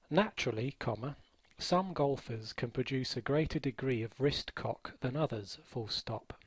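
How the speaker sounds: deliberate dictation, punctuation spoken aloud; slow dictation with punctuation. Shown as a demonstration. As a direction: read slowly and clearly for the software. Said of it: "I'm going to read from a book about golf".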